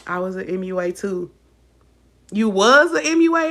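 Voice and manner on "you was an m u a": sarcastic echo of a viewer's comment; mock disbelief. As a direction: throw the claim back in disbelief. Mocking the commenter's claim back.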